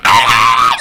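sudden loud, low mock scream; mock panicked yell. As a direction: shout as if losing it. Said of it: "I was like".

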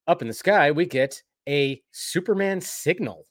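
normal talking voice

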